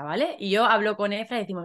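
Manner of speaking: ordinary delivery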